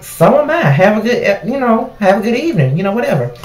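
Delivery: high, falsely chipper sign-off; sarcastically sweet goodbye; fake cheerful. Cashier answers customer with sarcastic fake cheer. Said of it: "And I'm like".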